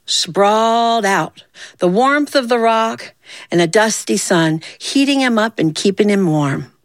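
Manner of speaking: ordinary delivery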